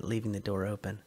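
usual speaking voice

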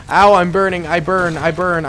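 loud, hammed-up cry of pain; mock burning soldier. As the German soldier, yelling that he burns. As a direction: ham up a soldier on fire. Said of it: "let's play as the Germans".

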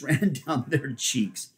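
ordinary delivery, nothing marked

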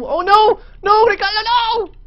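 high desperate cry; anguished shout. Real, not acted. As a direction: cry out in horror. Pet dying.

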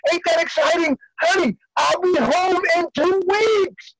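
excited, pitched well up; elated. Acted. Prisoner tells honey he's coming home, elated. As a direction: announce it like wonderful news.

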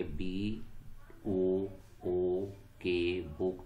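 slow, letter by letter spelling. Shown as a demonstration. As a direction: spell B-O-O-K one letter at a time, then say बुक. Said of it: "लफ्ज़ बुक में कितने spellings हैं". (अक्षर-अक्षर धीरे स्पेलिंग)

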